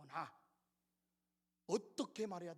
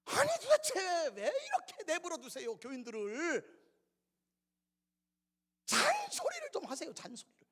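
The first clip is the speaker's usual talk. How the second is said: higher, insistent scolding voice; nagging deaconess; 7 semitones higher, 6 dB louder than usual. Retold or imitated. Deaconess urging the pastor to nag the congregation. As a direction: mimic the deaconess pushing the pastor. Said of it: "저한테 이제 그 얘기를 하셨어요".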